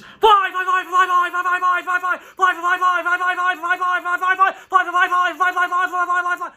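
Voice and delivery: high, loud crow squawk of a word; frantic high-pitched repeated bird shouting; crows teaching flight. Acted. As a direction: shout it nonstop like a cawing crow. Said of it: "That's how you teach the gift of flight".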